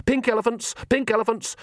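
high, insistent chant; urgent distraction. Acted. Nanny distracts Magrat from thinking, high and urgent. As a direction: drown out a thought urgently.

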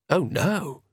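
usual speaking voice

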